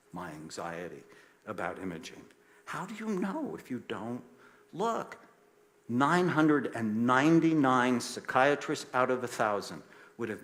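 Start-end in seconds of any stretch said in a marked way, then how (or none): 2.6-5.2 high-pitched, incredulous appeal; impassioned rhetorical question, very high on the last word; pleading challenge. Real, not acted. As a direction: pitch it up, pressing the obvious question. Rhetorical plea to the audience.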